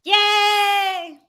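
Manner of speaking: high bright cry of joy; excited cheer. Real, not acted. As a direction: cheer the start of the show. Hosts cheer opening of live show.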